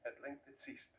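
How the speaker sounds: hushed whisper after a long pause; whispered final stillness. A narrator's reading. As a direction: let it die into a whisper. Heartbeat stops, hushed.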